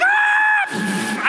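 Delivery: high terrified scream. Retold or imitated. Jumper screaming in freefall, high and terrified. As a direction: scream your lungs out falling. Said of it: "But I'm screaming".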